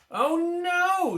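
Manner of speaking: high loud dismayed cry; alarmed cry. A narrator's reading. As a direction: gasp it out in alarm. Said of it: "she cried".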